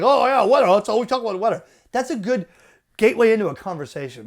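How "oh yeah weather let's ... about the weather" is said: mocking sneer, louder on the opening; roll-your-eyes complaint; sarcastic complaining listener; sarcastic mockery. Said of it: "people get pissed. Like".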